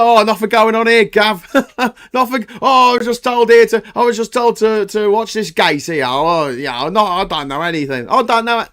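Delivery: high, loud hammed-up playing dumb; mocking the evasive insiders in a high put-on voice; mock innocent denial. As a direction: pretend you know nothing, broadly. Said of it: "all these people just like".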